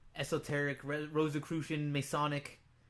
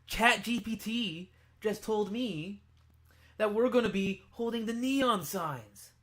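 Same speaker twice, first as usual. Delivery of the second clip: prophetic voice; 8 semitones higher, 10 dB louder than usual. Pretend. Grand prophetic announcement.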